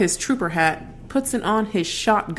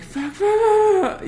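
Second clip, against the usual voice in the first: panicked cursing; 4 dB louder, slower than usual. Retold or imitated. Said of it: "and I'm like".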